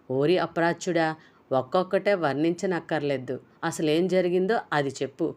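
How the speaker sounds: ordinary delivery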